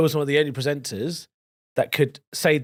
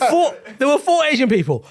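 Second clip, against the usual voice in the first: laughing; 9 semitones higher, 4 dB louder than usual. Real, not acted. Amused disbelief.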